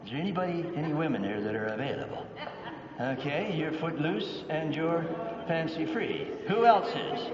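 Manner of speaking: ordinary talk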